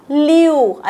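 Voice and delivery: "लिव्ह" said as a long "lee-oo"; common mispronunciation. Shown as a demonstration. Teacher shows what many learners say, "लिव्ह" mispronounced as "leeu". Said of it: "असा उच्चार होतो".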